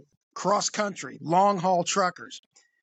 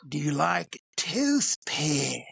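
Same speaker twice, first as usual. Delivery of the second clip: slower, falling creepy repeat; creepy trucker repeating; 3 semitones lower, much slower than usual. Retold or imitated. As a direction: repeat it slowly, flatly insistent. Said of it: "the guy goes again".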